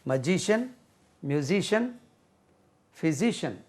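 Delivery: ordinary talk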